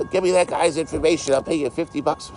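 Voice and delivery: high put-on voice mocking him; mock whiny streamer. Mocking the streamer who wanted a location, high mock voice. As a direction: mimic the weirdo in a high silly voice.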